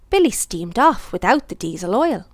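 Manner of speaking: plain reading voice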